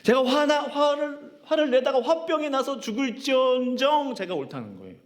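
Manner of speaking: high, insistent, defiant protest; stubborn Jonah. Retold or imitated. Jonah defiantly answering God, high stubborn insistence. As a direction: argue back to God as if you are sure. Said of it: "오 마이 갓".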